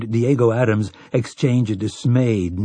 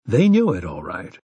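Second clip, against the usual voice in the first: usual speaking voice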